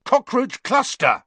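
high, loud shouted guess; angry yell. A narrator's reading. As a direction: hurl the password at the gargoyle. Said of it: "he yelled angrily".

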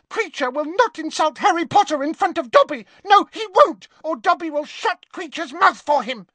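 very high squeaky house-elf cry, fast; little elf defending his hero; high-pitched Dobby. Acted. Dobby shouting at Kreacher, high-pitched and fast. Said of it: "cried Dobby in a high-pitched voice".

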